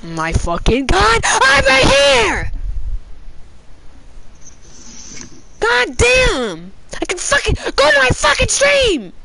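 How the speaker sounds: high, drawn-out furious yelling; raging gamer. Real, not acted. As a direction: rant at teammates in frustration. Raging at teammates.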